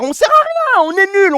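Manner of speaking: high-pitched, exaggerated wail; mock despair. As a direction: lament your team in hammed-up despair. Mock lament about his own team.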